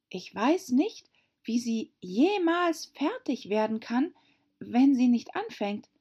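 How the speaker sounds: put-on high-pitched girlish storybook voice; puzzled young Alice. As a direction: wonder aloud in a high little voice. (helle, hohe Alice-Stimme, verwundert)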